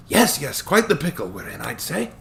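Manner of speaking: put-on chuckling old attorney, light Scottish touch; cheerful old Scottish lawyer.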